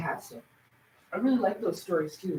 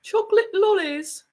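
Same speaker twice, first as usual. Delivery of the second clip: chocolate lollies lightly in a higher British lilt; mock British accent; 10 semitones higher than usual. Explaining word choice to David in a light British accent. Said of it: "you use different words".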